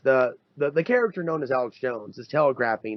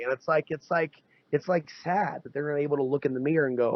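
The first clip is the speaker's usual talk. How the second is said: ordinary talk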